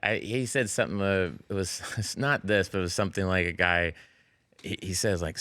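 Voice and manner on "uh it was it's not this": ordinary delivery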